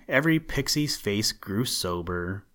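ordinary delivery

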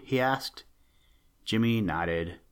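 usual speaking voice

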